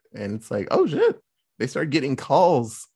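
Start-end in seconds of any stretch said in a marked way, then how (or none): 0.7-1.2 a sudden high yelp of dismay; surprised oh shit. Retold or imitated. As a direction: jump way up in sudden alarm. Sudden flood of calls, surprised.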